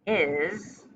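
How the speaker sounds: ordinary talk, nothing marked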